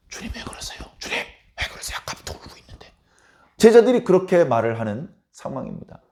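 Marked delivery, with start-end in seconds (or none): 0.1-2.9 whispered aside. Acted. Disciples murmuring embarrassed to Jesus.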